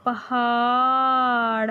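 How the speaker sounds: put-on पहाड़ stretched long for children; पहाड़ slowly drawn out; drawn-out word. Shown as a demonstration. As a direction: hold पहाड़ slowly for the class. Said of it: "ये है रे से".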